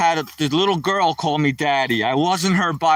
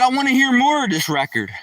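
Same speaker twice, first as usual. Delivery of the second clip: excited; 9 semitones higher, faster than usual. Retold or imitated. Excited dad in story.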